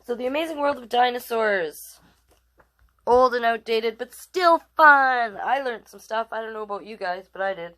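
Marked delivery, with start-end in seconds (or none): 3.1-5.4 louder, drawn out, playful; slow it down for fun; playful verdict. Real, not acted. Rating the book.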